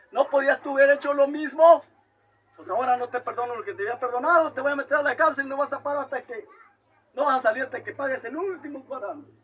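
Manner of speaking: loud and fast angry king's sentence; stern rebuke. Retold or imitated. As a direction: pass sentence in fury. King rebuking the wicked servant. (el rey reprende con enojo, fuerte y rápido)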